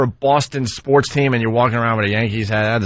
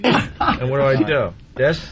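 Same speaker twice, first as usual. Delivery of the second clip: ordinary talk, nothing marked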